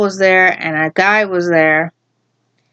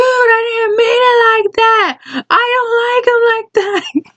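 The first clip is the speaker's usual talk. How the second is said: much higher, put-on protesting voice; high squeaky flustered protest; whiny denial; 13 semitones higher than usual. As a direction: whine that it was misunderstood. Guy protesting from hallway.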